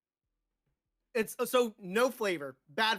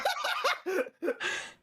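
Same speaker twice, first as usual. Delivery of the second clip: high burst of laughter; 11 semitones higher than usual. Real, not acted.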